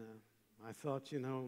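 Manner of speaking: ordinary talk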